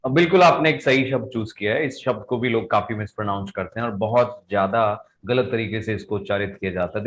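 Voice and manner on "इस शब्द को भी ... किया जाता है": ordinary delivery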